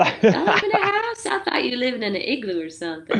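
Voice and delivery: high, laughing disbelief; incredulous Southern voice. Retold or imitated. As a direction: play a baffled Southerner. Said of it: "And they're like".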